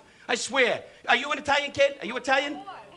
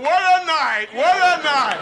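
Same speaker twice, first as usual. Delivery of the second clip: high, loud mock cheer; imitated excited kids; 4 semitones higher, 6 dB louder, slower than usual. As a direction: whoop it like kids in the front row. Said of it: "Kids in the front going".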